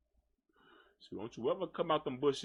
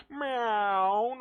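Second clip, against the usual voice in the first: louder, rising mock meow; cat meow; 5 semitones higher, 4 dB louder than usual. Imitating the cat begging for food, mock meow.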